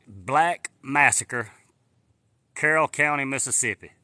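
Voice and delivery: spelling it out for viewers, slow; slow dictation. Real, not acted. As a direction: read it out slowly for a search. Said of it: "Go Google".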